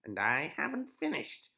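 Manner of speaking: loud, high menacing witch; loud high witch threat; witch cry. Acted. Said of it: "cried the witch".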